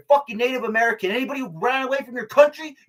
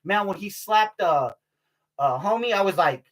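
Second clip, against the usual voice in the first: usual speaking voice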